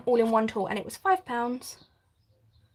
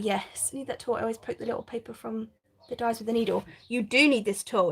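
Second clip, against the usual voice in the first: normal talking voice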